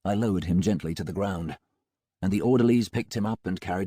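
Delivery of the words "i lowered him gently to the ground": ordinary delivery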